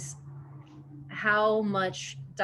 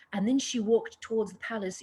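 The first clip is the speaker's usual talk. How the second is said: lightly put-on British accent; 2 semitones higher than usual. Actor mimics a writer's inner voice, British accent. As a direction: read it with a mock British accent. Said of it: "in a British accent".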